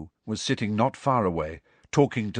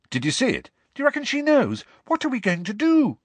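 high, excited rush of questions; agitated, high-pitched excitement; urgent questioning; 3 dB louder, faster than usual. A narrator's reading. Friends descend with urgent questions.

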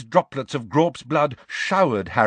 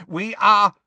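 loud, raised, cut off mid-sentence; proud declaration; 9 semitones higher, 6 dB louder than usual. A narrator's reading. Centaur declaring superiority to captives, proud and loud. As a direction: declare it proudly, higher and louder.

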